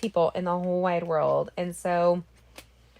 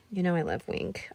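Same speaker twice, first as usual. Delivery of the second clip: normal talking voice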